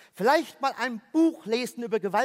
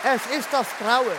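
mock horror; 2 semitones higher, 4 dB louder than usual. Wiederholt dramatisch.

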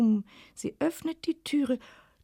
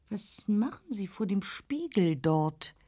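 ordinary delivery, nothing marked

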